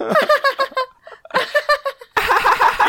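high-pitched cackle imitating a sleeper; long, eerie giggling fit, put on; imitated sleep laughter. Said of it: "started laughing in his sleep".

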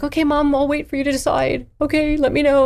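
lightly voiced agreeable kid; a touch of a child agreeing; mild kid voice; calm child. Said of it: "they're like".

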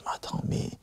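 voix soufflée, émue, qui s'éteint; presque chuchoté. Retold or imitated. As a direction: retenir ses larmes en chuchotant. Raconte ses larmes, voix chuchotée. (il se souvient des larmes aux yeux, à voix basse)

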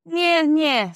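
put-on high, loud childish taunt; mocking, sing-song sneer; disagreeable. A narrator's reading. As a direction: jeer like a sulky boy. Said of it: "said Peter disagreeably".

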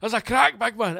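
hammed-up high squeaky mechanic; RAC guy. Mechanic telling him about the crack, high mock voice. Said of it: "he goes".

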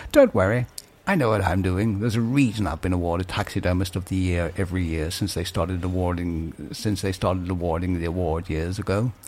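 a hint of a low, quiet taxidermist. Re-reads taxidermist line.

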